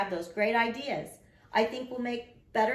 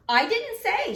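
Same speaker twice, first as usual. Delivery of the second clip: put-on high, loud little girl insisting; shouting; 7 dB louder, slower than usual. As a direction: shout it in defence. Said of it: "she shouted".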